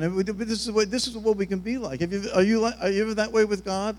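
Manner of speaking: ordinary delivery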